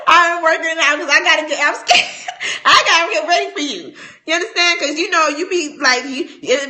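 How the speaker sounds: loud, high, laughing through it; giggle, playfully scared. Real, not acted.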